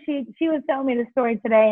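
ordinary talk

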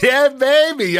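hammed-up victory yell; triumphant whoop. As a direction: celebrate like you just won. Celebrating the Rams' call.

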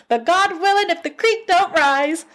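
hammed-up high-pitched take on a country saying; mock folksy saying. Shown as a demonstration.

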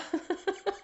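high, soft giggle through tears; teary giggle. Real, not acted. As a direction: giggle while welling up. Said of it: "like, laughing and crying".

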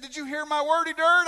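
high, loud imitated question; acting out an alarmed church member; called-out question. Acts out the church member calling out.

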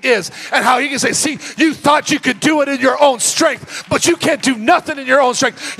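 loud, high sermon climax; full-force preaching. Real, not acted. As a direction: drive it home like a revival preacher.

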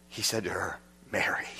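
whispered name, hushed and tender. Acted. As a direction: breathe the name gently. Jesus calls Mary by name.

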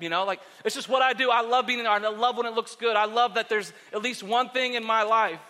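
ordinary delivery

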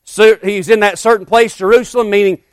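loud, ringing revival preaching; preaching at full force. Acted. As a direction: preach it out to the whole church.

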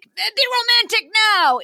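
high, loud mock command; mock pushy host. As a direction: bark it like a cheesy waiter. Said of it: "being like".

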